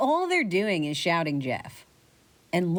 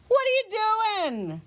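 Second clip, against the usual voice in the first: much higher, slow indignant "What are you doing?"; a startled girl ghost, pitched way up; slower than usual. Acted.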